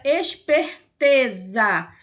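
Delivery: esperteza drawn out slowly with the stress on "te"; slow stressed reading. Shown as a demonstration. Teacher showing pupils the strong syllable, slow and deliberate. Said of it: "verifique que o T está, em preto mais escuro".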